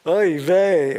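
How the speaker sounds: loud, high, exasperated groan of a word; exasperated oy vey. Retold or imitated. Grandson imitating his grandmother's loud exasperated oy vey. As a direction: throw up your hands and wail it. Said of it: "a combination of hand gestures".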